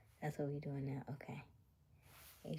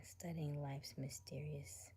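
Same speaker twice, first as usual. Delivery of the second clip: usual speaking voice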